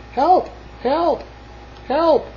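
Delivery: high-pitched repeated calls; crying for help. A narrator's reading. Stuck Little Blue Truck needs help, high cries. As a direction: call out high, each cry separate. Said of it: "cried the Little Blue Truck".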